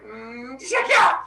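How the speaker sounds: mock battle cry on the release; imitated throwing effort. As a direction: wind up, then hurl it with a yell. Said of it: "and just like".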